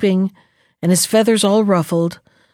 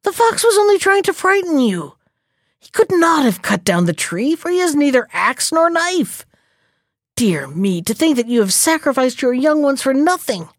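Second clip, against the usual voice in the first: scolding crow voice; 5 semitones higher than usual. Acted.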